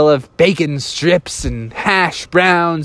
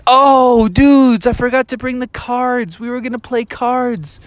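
put-on dismayed kid; 8 semitones higher than usual. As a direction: cry out that you've messed up. Kids realize they forgot cards.

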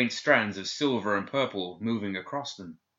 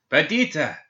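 high, loud cry of a name; yell; 7 semitones higher, 6 dB louder than usual. A narrator's reading. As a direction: yell the name, desperate to wake them. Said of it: "he yelled".

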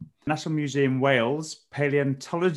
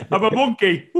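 laughing; 10 semitones higher, 10 dB louder than usual. Real, not acted. Laughing reveal of her zodiac animal.

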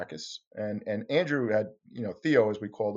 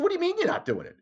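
very high, incredulous demand; can't believe it, pitched far up; faster than usual. Retold or imitated. Reporter to the photographer, very high incredulous. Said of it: "I said".

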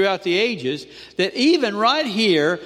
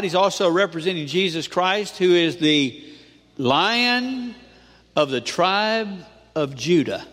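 ordinary talk, nothing marked

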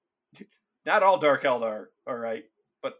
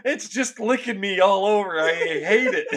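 disgusted panic; 5 semitones higher, 3 dB louder than usual. Acted. Spawn attacking acted soldier.

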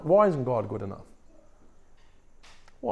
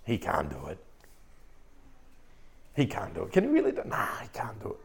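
low, soft grumbling mutter sinking to a whisper; doubting grumbler; 6 semitones lower, 11 dB quieter, faster than usual. Retold or imitated. Israelites doubting God in the wilderness, low grumbling mutter. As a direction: mutter the doubt under your breath, then whisper it. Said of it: "it might sound something like this".

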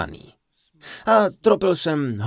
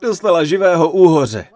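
dramatic reveal; 4 semitones higher, 9 dB louder than usual. A narrator's reading. Climax of the eel prank.

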